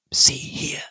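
unvoiced whisper. A narrator's reading. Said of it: "I whispered".

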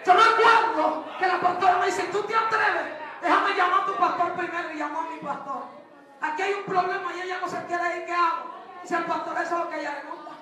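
loud, fast, rising preacher's climax; preaching at full force. Acted. Preacher rousing the church that the devil cannot kill them. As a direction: whip the crowd up, answering their amens.

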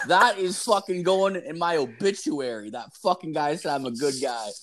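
put-on carrying on the drunk delight; a touch of giddy thrill; excited. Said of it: "I'm like".

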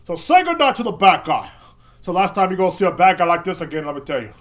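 grand exit in a rough gangster voice; Scarface. Acted. Banned man bidding the room goodnight, loud mock Scarface. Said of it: "can't do a good Scarface impression".

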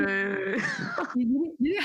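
laughing and squealing while tickled; being tickled. Real, not acted. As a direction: giggle and yelp through the words. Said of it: "gue geli banget dah".

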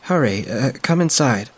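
a lightly voiced older woman, gently worried; concerned mother's friend. Friend finds her on the steps, lightly voiced concern.

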